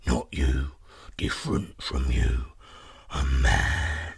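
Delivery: very deep, croaking giant; deep monster sniffing out prey; suspicious giant. Acted. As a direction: rumble it slowly at the bottom of the voice. Giant explains what he smells.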